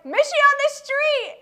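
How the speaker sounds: high excited shout from passerby. Retold or imitated. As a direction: yell it like a fan. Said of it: "that was like".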